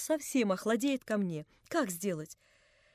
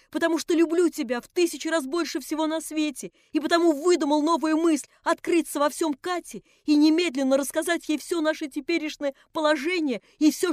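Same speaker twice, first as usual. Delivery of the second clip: higher and louder, gushing with rapture and remorse; rapturous remorseful cry; 6 semitones higher, 8 dB louder than usual. A narrator's reading. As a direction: burst out in delight and penitence. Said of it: "вскричал Алёша с восторгом и с раскаянием". (с восторгом и раскаянием, громко)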